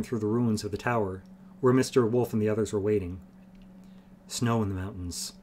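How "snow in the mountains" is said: soft, understated terse remark; laconic. A narrator's reading. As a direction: toss it off quietly, without fuss. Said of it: "remarked laconically".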